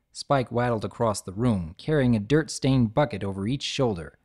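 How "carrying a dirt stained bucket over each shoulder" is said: usual speaking voice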